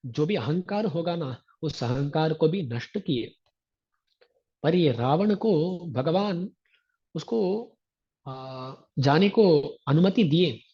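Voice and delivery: usual speaking voice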